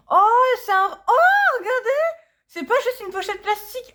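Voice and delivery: high-pitched delighted squeal, laughing; excited squeal. Real, not acted. Fan unboxing her album, thrilled by the packaging: squealing with delight. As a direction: gush over the surprise, pitch shooting up. Delighted by unexpected photocard sleeve.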